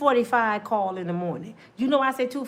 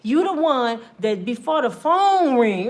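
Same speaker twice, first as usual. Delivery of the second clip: ordinary talk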